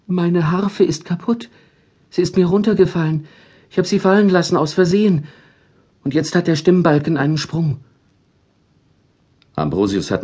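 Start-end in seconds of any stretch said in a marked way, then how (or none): 0.1-7.8 high, hurried small angel voice; guilty angel confession. A narrator's reading. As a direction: confess quickly and ashamed.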